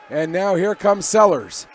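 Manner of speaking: ordinary delivery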